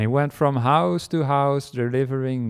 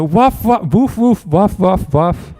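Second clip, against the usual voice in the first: loud, high rapid barking; loud high woofs from a chasing dog; 7 semitones higher, 7 dB louder, slower than usual. Acted. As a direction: bark hard as the dog charges. Dog charges the postman.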